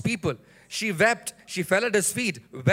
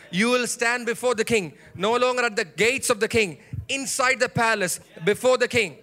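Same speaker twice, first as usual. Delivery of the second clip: raised, driving preacher's delivery; rousing preaching; 3 semitones higher, 4 dB louder than usual. Real, not acted. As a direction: proclaim the promise with building force. Preaches promise to congregation.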